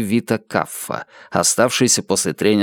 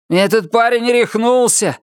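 higher-pitched indignant complaint; complaining; 10 semitones higher than usual. A narrator's reading. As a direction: gripe in a raised pitch. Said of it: "пожаловался костюм Джареду".

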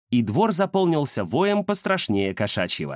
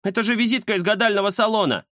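excited; 10 semitones higher, faster than usual. Acted. Kuzya protests, fast.

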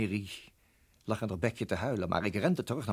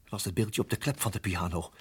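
ordinary talk, nothing marked

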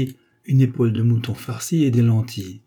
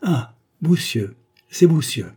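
pleased discovery; 5 semitones higher, 4 dB louder than usual. A narrator's reading. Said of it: "croyant avoir trouvé".

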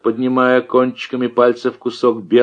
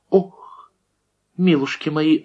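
high shocked gasp; 7 semitones higher than usual. A narrator's reading. A woman gasps at the frog bones, high and startled. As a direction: cry out in alarm. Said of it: "ахнула одна из баб".

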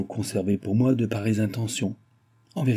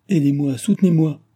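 higher, louder plea; crying for help; 6 semitones higher, 6 dB louder than usual. Retold or imitated. Nicole recalling her plea to Gilbert, desperate cry. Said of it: "J'ai crié".

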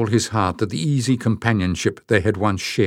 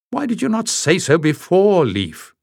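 much higher sharp demand; 10 semitones higher than usual. A narrator's reading. Jasmine demands Leif explain, much higher and sharp. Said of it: "Jasmine demanded".

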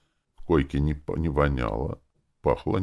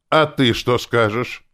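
menacing question; 8 semitones higher, 6 dB louder, slower than usual. Acted. Clerk threatening last mage.

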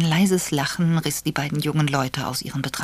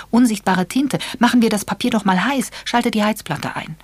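put-on higher, louder eager young woman; 9 semitones higher, 6 dB louder than usual. Charmian proposes heating the paper, eager and high. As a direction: burst out with the clever idea.

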